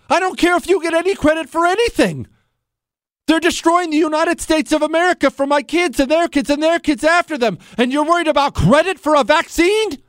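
high, fast angry tirade; heated rant. Real, not acted. Host rails at Trump over vaccine credit, heated. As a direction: let the anger boil over.

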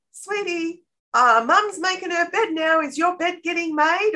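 high, singsong mum voice; sweet coaxing. Acted. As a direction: coax a child cheerfully from across the room. Acts mum coaxing her child.

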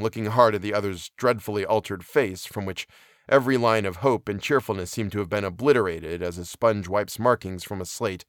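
plain reading voice

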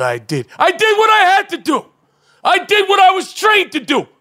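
mock traumatized veteran. Veteran at a press conference facing reporters, anguished outbursts. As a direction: play the haunted soldier at a press conference. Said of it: "that's how I had, I envisioned, uh, the press conference".

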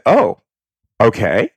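retold reaction of mild surprise; taken aback. Woman tells of a stranger's blunt proposition, taken aback.

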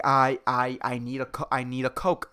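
a hint of a zombie craving a Coke; dazed consumer. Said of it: "and I'm like".

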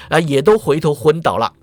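plain reading voice

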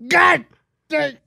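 a loud, high mock "God dang"; mock dad exclaim. Guy imitating the dad's loud surprise. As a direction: ham up the dad's outburst.

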